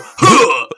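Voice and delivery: sharp effort grunt on the jump; loud jump grunt. Retold or imitated. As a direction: grunt like a video game hero. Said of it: "I would jump and go".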